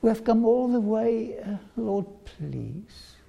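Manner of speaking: mild acted anguish, desperate pleading; pleading cry. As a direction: beg the Lord not to leave you out. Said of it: "Listen to their cries".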